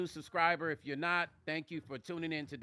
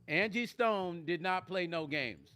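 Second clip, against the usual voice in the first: normal talking voice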